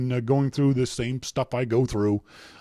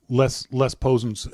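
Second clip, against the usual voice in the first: normal talking voice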